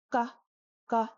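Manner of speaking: "ka" with no puff of air, almost "ga"; soft unaspirated K. Shown as a demonstration. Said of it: "Now this is too soft".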